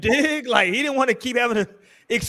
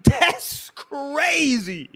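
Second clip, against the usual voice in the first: long, drawn-out cry of disbelief; incredulous exclamation; 4 semitones higher, much slower than usual. Real, not acted. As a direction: stretch it out slowly, high and exasperated. Incredulous climax of his rant.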